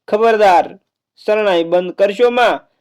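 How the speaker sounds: commanding call. Acted. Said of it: "રાજકુમારીએ હાકલ મારી".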